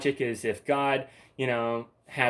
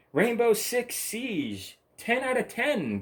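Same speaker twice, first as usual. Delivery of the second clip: mock promo voice; 11 semitones higher, slower than usual. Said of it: "GameStop says".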